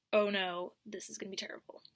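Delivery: whispered, dreading what comes next. Retold or imitated. Telling how the bus trip turned, hushed dread whisper.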